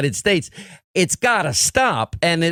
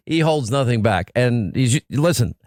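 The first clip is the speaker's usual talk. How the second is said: ordinary talk, nothing marked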